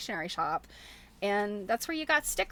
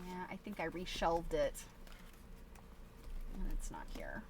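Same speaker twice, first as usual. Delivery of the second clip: a disappointed whisper under your breath; whispered aside; 3 semitones lower, 10 dB quieter than usual. Real, not acted. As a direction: whisper it to yourself. Muttering to herself while searching.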